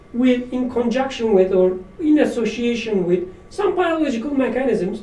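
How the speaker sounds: ordinary delivery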